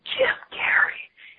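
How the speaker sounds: slow, drawn-out stage whisper; yell whisper. Retold or imitated. Shelf stocker whispers the celebrity's name across the shop. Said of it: "that, like, yell whisper".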